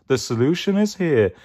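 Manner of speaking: confident hippie-dude character voice; Mystic Mango. Acted. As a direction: announce it like a cool mystic. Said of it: "It's Mystic Mango".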